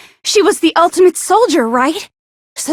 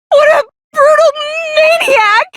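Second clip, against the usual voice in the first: shocked outburst; 10 semitones higher than usual. Acted. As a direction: shriek it out in horror.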